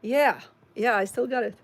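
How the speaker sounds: high, loud burst of glee; excited triumph. Real, not acted. To chat viewers after wiggling her ears, loud gleeful crowing. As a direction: crow with delight at the trick.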